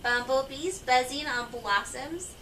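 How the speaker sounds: ordinary delivery, nothing marked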